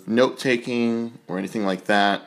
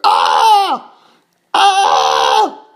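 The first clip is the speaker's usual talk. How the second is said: imitated screaming; 6 semitones lower, 9 dB louder than usual. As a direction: scream like a woman fighting the cops. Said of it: "she is not going quietly".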